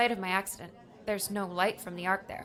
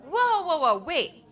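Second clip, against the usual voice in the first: loud, higher, stunned protest; startled outburst; 6 semitones higher, 7 dB louder, slower than usual. Acted. Ali interrupts Erland and Walter in loud disbelief. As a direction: stop them mid-explanation, caught off guard.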